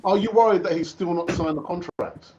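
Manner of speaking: normal talking voice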